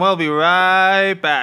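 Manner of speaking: loud, drawn-out sign-off; big slow throw to a break; show host break announcement. Pretend. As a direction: stretch it out loudly for the break. Calls a break mid-recording.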